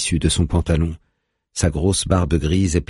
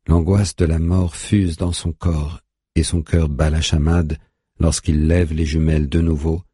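plain reading voice

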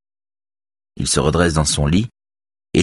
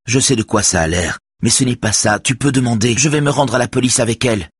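upset; 7 semitones higher than usual. A narrator's reading. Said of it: "dit Robert bouleversé".

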